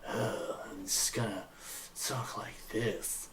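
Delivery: soft, slow, sighing character voice; character's voice. Acted. Player showing how his character talked, in a soft slow voice. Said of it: "he was like".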